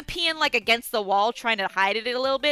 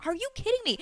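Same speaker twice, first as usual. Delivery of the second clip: retold disbelief; 9 semitones higher, 6 dB quieter, faster than usual. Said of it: "I'm like".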